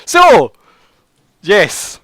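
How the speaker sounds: ordinary talk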